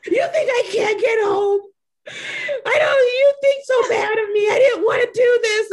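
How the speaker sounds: high, loud, sobbing wail; mock crying. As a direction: wail through fake tears. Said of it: "the tears start coming".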